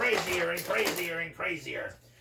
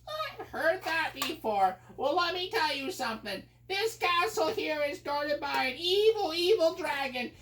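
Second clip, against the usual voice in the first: much higher, slow mocking wizard; scornful wizard voice; 10 semitones higher than usual. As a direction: sneer it down at the prince. Wizard warns the prince.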